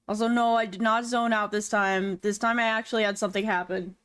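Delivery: normal talking voice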